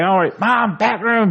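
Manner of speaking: high mock yell for mom; whiny cartoon boy summoning his mother; Cartman. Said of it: "He yells the intercom".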